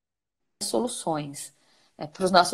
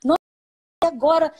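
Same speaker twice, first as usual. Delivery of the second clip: higher, louder, playing fragile and lost; a fake little cry for help; mock helplessness; 5 semitones higher, 5 dB louder, faster than usual. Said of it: "só você ficar frágil". (desamparo fingido, mais agudo)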